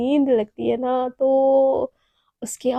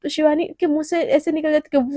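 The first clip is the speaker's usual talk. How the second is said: ordinary delivery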